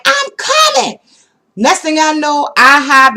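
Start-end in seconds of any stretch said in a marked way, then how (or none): 0.0-1.0 high, loud breathless reply; high yell, hurrying; calling back. Retold or imitated. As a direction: shout back while running. Calling back while running.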